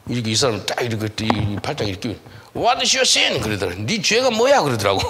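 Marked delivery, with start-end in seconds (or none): 2.5-3.4 loud, high-pitched retold challenge; confrontational demand. Retold man confronting the pastor. 3.8-4.6 confrontational demand. Retold or imitated. Same challenge retold in Korean.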